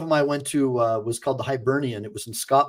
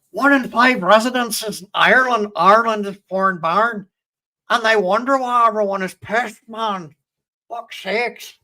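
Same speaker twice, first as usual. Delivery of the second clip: higher, louder mock Irish accent; bantering Irish accent, pitched up; 8 semitones higher, 5 dB louder than usual. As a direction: put on a hammed-up Irish accent. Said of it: "Hopefully I did a good, kind of a decent Irish accent".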